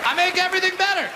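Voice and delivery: high, fast proud proclamation; proud catchphrase. Acted. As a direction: sell the catchphrase big. Catchphrase repeat.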